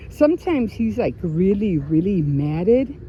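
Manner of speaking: ordinary delivery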